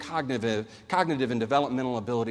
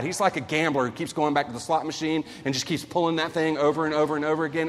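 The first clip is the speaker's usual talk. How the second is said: ordinary talk, nothing marked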